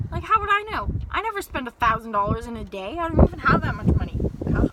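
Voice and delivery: loud, fed-up venting about the limit; annoyed outburst at the bank's rule; exasperated rant. Real, not acted. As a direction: throw up your hands and complain. Ranting about the daily limit.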